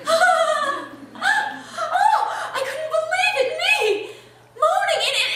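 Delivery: loud, very high, gasping delight; moan, then exclaim in breathless disbelief; moaning astonishment. Acted. Woman amazed at herself moaning in a gas station, ecstatic. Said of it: "I moaned".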